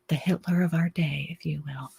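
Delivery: hushed, trailing into a whisper; whispered aside. Real, not acted. Slipping in a damning label for Gates, whispered. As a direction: slip the label in under your breath.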